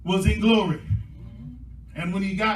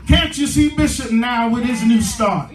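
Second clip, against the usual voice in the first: loud, raised-pitch sermon climax; full-force preaching; 4 semitones higher, 6 dB louder than usual. Real, not acted. Preacher rallying the saints to a new beginning, full-force preaching. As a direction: drive each phrase home, stretching the big words.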